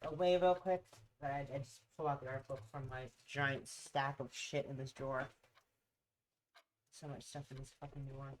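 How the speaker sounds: usual speaking voice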